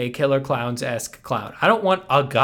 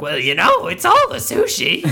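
clown voice; 4 semitones higher, 3 dB louder than usual. Pretend. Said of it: "he's like".

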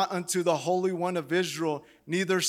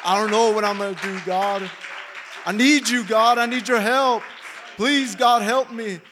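loud desperate plea, voice raised high; crying out to God; 3 semitones higher, 6 dB louder than usual. Retold or imitated. A believer cries out to God for help, loud desperate plea. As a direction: cry out to God for help, pleading hard. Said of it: "just say".

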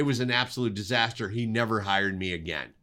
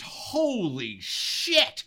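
amazed exclamation; 9 semitones higher, much slower than usual. Retold or imitated. DP stunned by the lightning.

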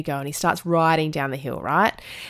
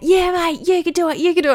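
high-pitched imitation of a cheering dad; yelled encouragement; 11 semitones higher, 3 dB louder than usual. Dad from across the park cheering the boy on, yelled encouragement. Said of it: "their dad starts yelling out".

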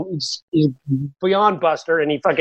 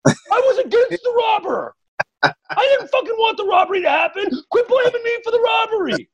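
mock indignant rant; 12 semitones higher than usual. Mocking the neighbor who did nothing with a high indignant shout. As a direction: whine it like an outraged caricature. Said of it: "And go".

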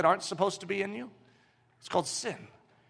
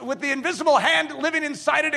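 usual speaking voice

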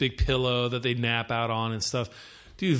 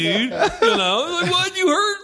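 laughing; 5 dB louder, slower than usual. Real, not acted. Retelling while laughing.

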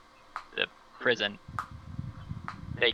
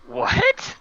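a much higher, louder, incredulous what; shocked; 6 dB louder than usual. Acted. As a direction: shriek the word in disbelief. Shocking news over earpiece.

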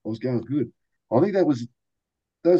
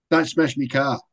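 higher mock warning from the team owner, "car" dropped to "kah"; boss's warning; 5 semitones higher, 3 dB louder, slower than usual. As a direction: warn the rookie like a gruff owner. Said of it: "And he goes".

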